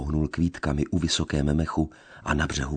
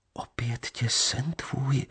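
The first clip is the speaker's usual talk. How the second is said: slow, breathless whisper, much higher; frightened whisper; 8 semitones higher, 4 dB quieter, slower than usual. A narrator's reading. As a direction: barely breathe the words in fear. Lea answers fearfully.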